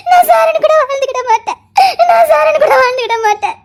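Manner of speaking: weeping confession; tearful pleading. Acted. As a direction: cry it out, begging.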